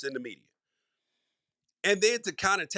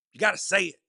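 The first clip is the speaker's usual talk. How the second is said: louder forceful delivery, hitting every word; 3 semitones higher, 5 dB louder, faster than usual. Shown as a demonstration. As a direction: say it with conviction and passion, like a TV pundit. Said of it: "you gotta say it with force".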